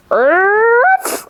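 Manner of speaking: high, yappy imitation of a dog's arf; dog bark. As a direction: yap it like a small dog, up high. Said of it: "She barks a lot".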